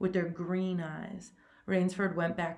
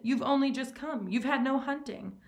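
wounded protest, pitched higher; hurt host pressing his guest; mild, high, hurt objection; 7 semitones higher, 4 dB louder than usual. A narrator's reading. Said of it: "the general protested".

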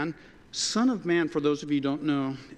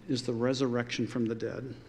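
ordinary delivery

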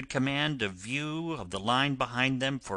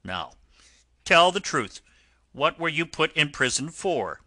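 higher, louder stern demand; firm, raised-voice interrogation; 5 semitones higher, 5 dB louder than usual. A narrator's reading. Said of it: "looked down upon him very sternly".

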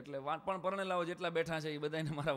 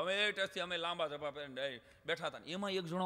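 ordinary talk

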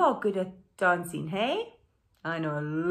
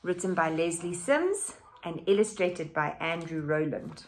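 bright, louder storybook reading; animated picture book read-aloud; reading to children; 7 dB louder than usual. A narrator's reading. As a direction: read it out lively for the class.

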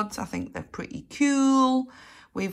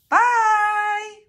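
squeaky high sign-off; high chirpy goodbye; 12 semitones higher, 4 dB louder, slower than usual. Acted. High farewell.

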